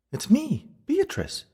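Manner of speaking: very high, feminine character voice; ghost woman. A narrator's reading. Narrator voices the female ghost.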